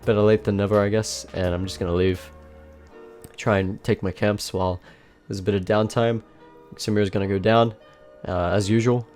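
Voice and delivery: usual speaking voice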